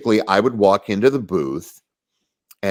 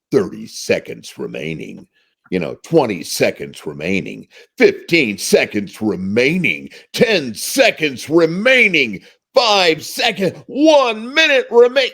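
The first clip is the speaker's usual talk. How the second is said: countdown building to excited, higher; announcer countdown; 6 semitones higher than usual. Retold or imitated. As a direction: ramp up excitement each line. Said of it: "You just sort of get more excited about it".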